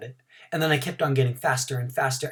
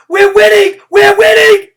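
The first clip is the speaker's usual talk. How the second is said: very high, loud triumphant chant; excited cheer; 17 dB louder than usual. Acted. Room nine cheering that they are winning, very loud. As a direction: crow it to the whole field.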